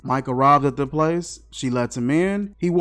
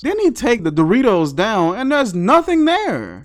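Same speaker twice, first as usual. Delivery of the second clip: ordinary talk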